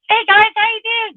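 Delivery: high, tiny voice of a huge man; little voice. Retold or imitated. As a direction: squeak it like a big guy with a little voice. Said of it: "Just little voice".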